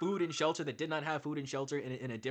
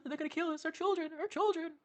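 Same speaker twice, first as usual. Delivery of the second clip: much higher, hammed-up panic; mock panicked crowd; 10 semitones higher, 4 dB quieter, faster than usual. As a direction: squeal like a frantic villager. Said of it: "Everyone's like".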